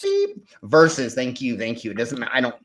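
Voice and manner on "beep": high buzzer beep sound. Pretend. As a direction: make a game-show buzzer noise. Buzzer noise for wrong word.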